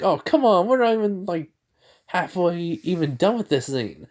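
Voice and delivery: high, whiny protest from Tails. Retold or imitated. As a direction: complain in a high pitch it's not halfway done. Tails complaining in retelling.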